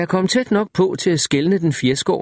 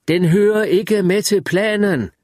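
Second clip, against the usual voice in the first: shouted; 7 semitones higher, slower than usual. A narrator's reading. Narrator shouts back to Wireman from down the beach, slow and raised. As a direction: call it across the sand, drawn out. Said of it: "råbte jeg".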